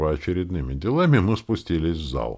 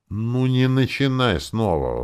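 higher, slow, drawn-out plea; raised, imploring voice; pleading; 8 semitones higher, 5 dB louder, slower than usual. A narrator's reading. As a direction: beg her to stop. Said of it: "взмолился Перч".

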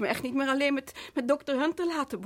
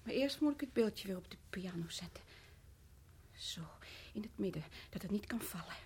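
lower, much softer whisper; eerie whisper; 7 semitones lower, 13 dB quieter than usual. Acted. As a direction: murmur it to herself. Wife places statue, whispering.

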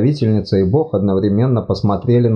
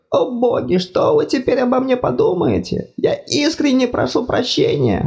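high fussy god voice, apologetic; flustered deity, much higher pitched; embarrassed god. Acted.

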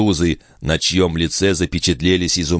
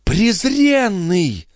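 high, drawn-out furious cry; enraged woman's outcry; crazed with rage; 14 semitones higher, much slower than usual. A narrator's reading. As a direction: hurl the insult, stretching it out. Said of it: "вскричала Андреа, обезумев от ярости".

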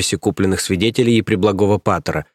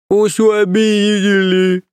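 put-on high, slow childish whine; whiny complaint; 11 semitones higher, 4 dB louder, much slower than usual. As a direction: whimper it like an overgrown baby. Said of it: "пожаловался Грюнт".

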